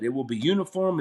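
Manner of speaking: usual speaking voice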